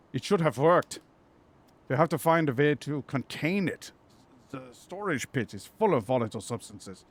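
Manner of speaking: hammed-up German accent; Otto, German accent. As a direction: plead the case in a jokey German accent. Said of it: "My German accent is a joke".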